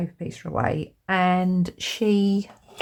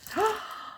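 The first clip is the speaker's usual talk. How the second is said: gasp; 5 dB quieter than usual. Real, not acted. Spots the sunflower stickers.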